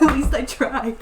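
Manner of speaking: loud laugh into the words; amused, loud, laughing excuse. Real, not acted. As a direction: laugh off a failed attempt. Laughs off the backfired plan.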